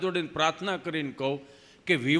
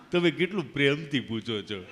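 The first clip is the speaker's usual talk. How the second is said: lower, halting voice with a laugh; Gabho, laughing; 5 semitones lower than usual. Retold or imitated. Friend teasing the kind nurse, halting with a laugh. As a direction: chuckle it out tongue-tied. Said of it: "જીભ જલાય સોંટે અટકે".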